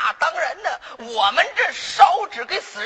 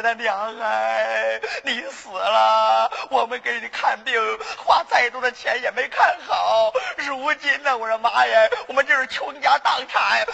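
mock funeral wailing. Shown as a demonstration. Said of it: "你咋着哭啊". (假哭丧，拖腔哭诉)

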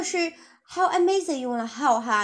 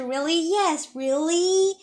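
incredulous; 3 semitones higher, much slower than usual. Shown as a demonstration. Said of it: "是这种反问的语气".

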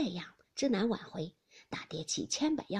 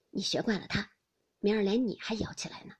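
ordinary delivery, nothing marked

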